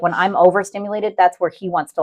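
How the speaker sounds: normal talking voice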